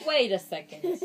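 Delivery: plain reading voice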